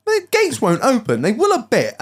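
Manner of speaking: high-pitched puzzled complaint; retold exasperation. Telling friend his gates are stuck, high exasperated voice. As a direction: pitch it up in mild disbelief. Repeats gate complaint, voice raised.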